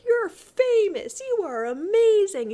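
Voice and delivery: high, loud, drawn-out fan praise; gushing. Retold or imitated. She praises famous Gawain, gushing loudly. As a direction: gush with exaggerated awe.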